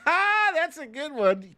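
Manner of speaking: ordinary talk, nothing marked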